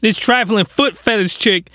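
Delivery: ordinary talk, nothing marked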